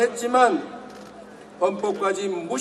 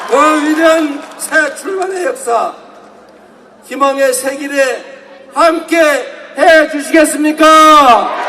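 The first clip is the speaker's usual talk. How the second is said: fiery revolutionary speech; 4 semitones higher, 6 dB louder than usual. Real, not acted. Rally crowd-rousing climax.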